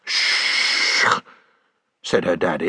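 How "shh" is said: hushing shh. Acted. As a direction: hush her off. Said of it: "frowned to himself".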